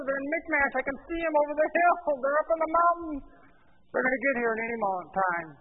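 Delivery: high, loud, urgent protest; alarmed excuses. Acted. Saul blames circumstances as enemy forces close in, alarmed. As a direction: pile up the threats in rising alarm. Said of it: "then he blames the circumstances".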